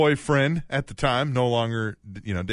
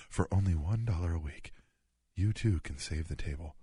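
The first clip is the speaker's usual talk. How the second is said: charity ad voice; 5 semitones lower, 6 dB quieter than usual. Retold or imitated. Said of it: "Remember those, like, uh, Children's Network commercials?".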